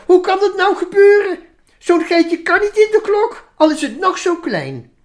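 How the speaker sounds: indignant sheep. Acted. Sheep Veronica protesting to the minister, indignant high voice. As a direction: object like a fussy little sheep.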